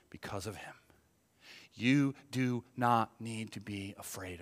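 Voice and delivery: low, soft delivery with long pauses; hushed solemn preaching. Real, not acted. Preacher telling congregation not to fear death, hushed. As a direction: bring it right down to earnest reassurance.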